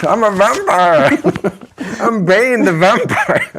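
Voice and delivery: high, loud, slow vampire voice; hammy vampire. Acted. Host jokes he is Bane the vampire, hammy loud voice. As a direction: announce yourself as a vampire, hamming.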